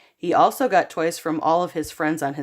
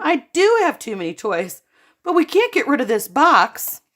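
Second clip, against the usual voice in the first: higher, louder called reply; calling out; 6 semitones higher, 5 dB louder than usual. A narrator's reading. Boy calling from his bedroom to mom, called out. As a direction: call from another room. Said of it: "Spencer called from his bedroom".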